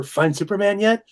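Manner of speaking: eager retold question. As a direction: pester lightly, pitch climbing to "yet". Retelling his repeated childhood question.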